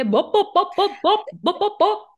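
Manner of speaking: high popping bop bop; goldfish bubble pops. Acted. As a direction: pop like a goldfish blowing bubbles. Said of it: "like a goldfish blowing bubbles in a tank".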